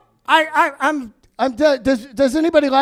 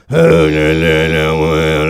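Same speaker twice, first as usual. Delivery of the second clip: much lower, buzzing electrolarynx voice; tracheotomy voice. Retold or imitated. Man with a tracheotomy at the door, low buzzing voice. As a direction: rasp it through a voice box. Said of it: "He's like".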